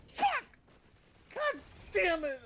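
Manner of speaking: very high shriek of dismay. Acted. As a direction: shriek like the bad news just hit. Moses sees tablets, shrieks.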